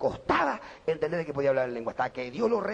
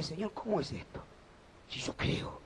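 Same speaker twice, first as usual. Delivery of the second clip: hushed reply; 8 dB quieter, slower than usual. Retold or imitated. Retold confused reply to God.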